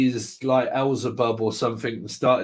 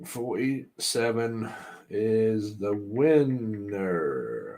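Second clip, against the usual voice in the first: very slow, hushed mock announcer; 3 semitones lower, 6 dB quieter, much slower than usual. Host declares forty-seven the winner, slow mock announcer. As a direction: draw out every word, sinking low on the last.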